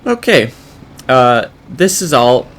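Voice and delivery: startled. Real, not acted. Combine struggling, sudden alarm.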